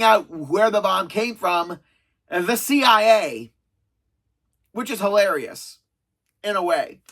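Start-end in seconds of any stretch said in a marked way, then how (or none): none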